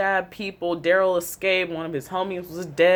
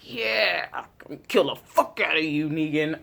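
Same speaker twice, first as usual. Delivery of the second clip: echoing Rick. Retold or imitated. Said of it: "I was just like".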